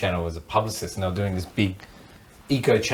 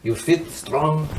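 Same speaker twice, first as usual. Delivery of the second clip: slow, higher mock Moroccan accent; imitated Moroccan accent; 5 semitones higher, much slower than usual. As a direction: imitate the guide's accent, sizing someone up. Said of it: "he's making comments like".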